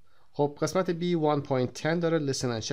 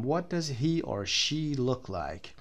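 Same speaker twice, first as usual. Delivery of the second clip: slow reading; much slower than usual. Shown as a demonstration. Teacher reads book question slowly.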